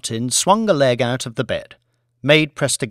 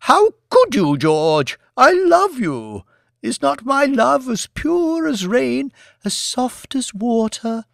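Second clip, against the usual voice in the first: high, slow melodramatic lament; theatrical wife; 12 semitones higher, 4 dB louder than usual. A narrator's reading. Wife pleads her pure love, high and hammed-up. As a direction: plead like a tragic heroine on stage. Lady Elizabeth's mock-tragic plea.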